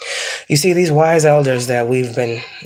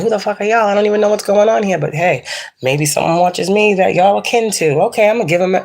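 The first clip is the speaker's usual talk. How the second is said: past self; 6 semitones higher than usual. Retold or imitated. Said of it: "And I'm like".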